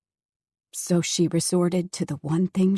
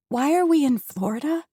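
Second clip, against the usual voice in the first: higher, louder worried ask; anxious rising question; 6 semitones higher, 4 dB louder than usual. Acted. Girl asking her father, anxious. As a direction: push the question up, uneasy. Daughter confronting father in car.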